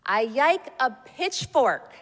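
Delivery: put-on slow, high, loud little-kid voice; toddler voice. A narrator's reading. Child telling Santa his wish, slow loud toddler voice. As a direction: spell out the wish like a small child. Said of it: "my child continued".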